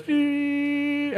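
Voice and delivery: high, thin mock beep of a heart monitor. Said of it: "the heart monitor goes".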